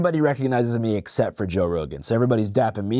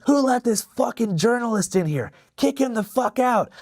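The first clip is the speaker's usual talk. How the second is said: Joe Rogan yelling; 12 semitones higher than usual. Retold or imitated. Comic imitates club owner yelling at security guard, outraged. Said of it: "And he's yelling".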